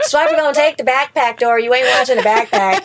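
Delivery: loud, exasperated yelling at a cartoon. Sleep-deprived mom yelling at Dora on TV, loud and exasperated. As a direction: shout at the screen like a sleepless mom. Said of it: "now I'm yelling at the T V".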